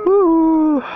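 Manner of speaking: high, drawn-out whoop; long "woo" soaring up high; excited whoop. Real, not acted. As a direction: let out a loud high woo. Whooping during a chase in game.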